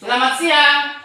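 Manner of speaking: "Selamat pagi" called out high and loud; called greeting. Shown as a demonstration. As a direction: sing out the greeting to the class. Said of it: "kalau sudah bisa konsentrasi". (salam "Selamat pagi" diserukan tinggi dan keras)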